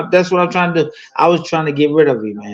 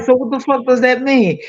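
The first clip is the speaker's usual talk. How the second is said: higher, puzzled voice; retold confused demand; 9 semitones higher than usual. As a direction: push for an answer, lost. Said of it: "I'm like".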